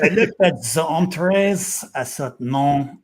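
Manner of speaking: slow mock French accent; a light French golfer voice, slow. Host quotes Antoine Rosner in a slow French accent. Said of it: "sticking with accents".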